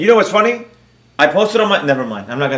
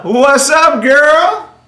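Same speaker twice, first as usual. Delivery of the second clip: high-pitched, rising hello; teasing high lilt; playful greeting; 7 semitones higher, much slower than usual. Real, not acted. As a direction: swoop up high to greet a friend. Playful greeting to arriving guest.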